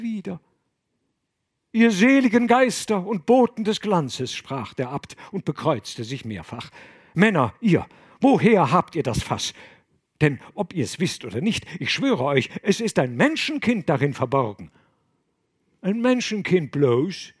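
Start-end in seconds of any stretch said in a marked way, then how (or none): 1.7-4.3 loud, high awestruck cry; awed invocation. Acted. Abbot invokes spirits in awe. 7.1-14.7 louder, emphatic abbot; urgent declaration. Acted. Abbot swears a child inside. 15.8-17.3 louder, high scoffing fisherman; mocking question.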